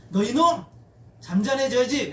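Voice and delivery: mock scolding. Preacher acting out Jesus rebuking wind and waves, scolding. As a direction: scold sharply, as if at an unruly person. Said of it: "라고 꾸짖으신 거예요".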